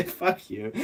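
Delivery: high, loud burst of laughter; helpless real laughter around a curse; laughing fit. Real, not acted. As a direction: crack up for real.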